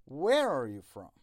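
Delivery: "where" punched loud, "are you" slipped in unstressed; stress on where and from. Shown as a demonstration. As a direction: lean on "where" and "from", glide over "are you". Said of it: "are you رو نباید با استرس بگین".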